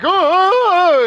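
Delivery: loud, very high comic panic cry; mock panicked wail. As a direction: imitate a terrified neighbor fleeing. Mimics panicked neighbour fleeing.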